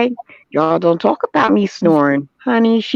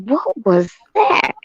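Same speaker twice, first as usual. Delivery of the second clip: high startled what was that; startled question; 7 semitones higher, slower than usual. Retold or imitated. Retelling being startled in the night, high startled question. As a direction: ask it like you just got spooked. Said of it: "It was like".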